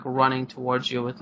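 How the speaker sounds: ordinary delivery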